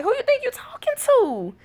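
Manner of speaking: high-pitched mock indignation; indignant. Mom talks back to screaming baby, indignant. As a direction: fire back in comic outrage.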